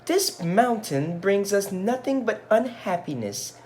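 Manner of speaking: high, complaining wife voice; high-pitched fed-up wife; wife's voice. Acted. Wife voiced in read-aloud story.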